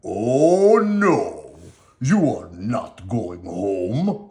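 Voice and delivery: loud villain voice, slowing to a gloating drag; a puppet master refusing, loud then slow; menacing Stromboli. Acted. Stromboli telling Pinocchio he stays, loud and menacing. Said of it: "said Stromboli".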